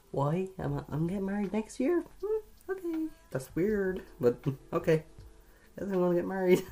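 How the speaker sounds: half-laughing, high and incredulous, as if the quiz can't be serious; squeaky amused disbelief. Real, not acted. Reading she'll marry within a year, high amused disbelief. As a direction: pitch jumps way up in giggly surprise.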